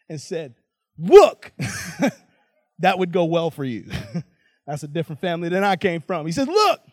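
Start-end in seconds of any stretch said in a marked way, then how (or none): 1.0-1.5 loud, pitched-up "look" at a father; mock defiant outburst; disrespectful. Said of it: "disrespects his father by saying". 6.5-6.8 high, rising, indignant "look"; acted insolent outburst, pitched up sharply; disrespectful. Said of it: "disrespects his father by saying".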